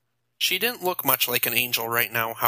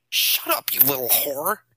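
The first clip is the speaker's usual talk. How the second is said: angry shout; 2 semitones higher than usual. A narrator's reading. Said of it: "Nathan shouted".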